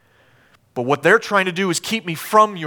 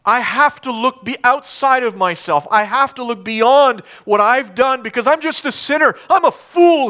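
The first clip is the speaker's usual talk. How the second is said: raised, higher pleading preacher's voice; impassioned confession; 9 semitones higher, 7 dB louder than usual. Acted. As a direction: build to a loud, high outcry. David's impassioned confession, acted.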